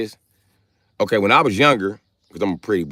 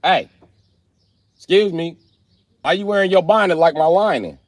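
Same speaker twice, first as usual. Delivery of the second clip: bright teasing flirt with a giggle; flirty call-out; 11 semitones higher than usual. Real, not acted. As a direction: tease a stranger sweetly. Flirting with passer-by.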